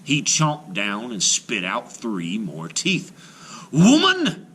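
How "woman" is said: loud high outraged giant yell; giant's cry. Acted. Giant crying out at Una over lost teeth, loud. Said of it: "he cried".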